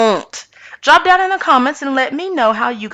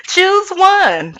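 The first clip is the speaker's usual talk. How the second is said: sarcastic demand; 12 semitones higher than usual. Retold or imitated. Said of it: "but I'm like".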